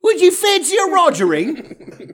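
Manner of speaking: high, slow hammed-up British accent; mock British accent. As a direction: lay on a posh Brit offer. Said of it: "British accent".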